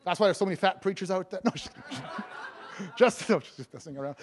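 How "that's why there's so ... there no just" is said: ordinary talk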